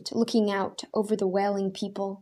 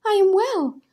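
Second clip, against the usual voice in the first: much higher, loud cry of joy; joyful cry; 12 semitones higher, 7 dB louder than usual. A narrator's reading. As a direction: cry out that you are cured. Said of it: "a woman cried".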